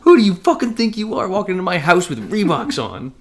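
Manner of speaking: high-pitched incredulous tirade; mock outrage. Bouncer appalled at Reeboks in his house, mock outrage. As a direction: screech it in comic disbelief.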